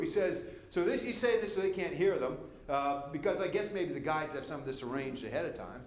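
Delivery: ordinary talk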